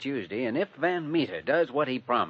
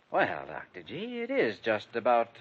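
ordinary talk, nothing marked

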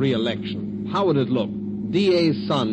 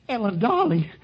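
high, anxious endearment; anguished pleading; 10 semitones higher than usual. Acted. Man to his injured sweetheart, anguished. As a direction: plead for a sign of life.

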